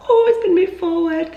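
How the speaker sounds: high, loud, delighted squeal at the news; mock excited squeal. Retelling the phone call, squealing with mock delight. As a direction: gush like the date came early. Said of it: "I was like".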